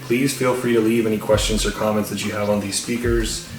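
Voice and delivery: ordinary delivery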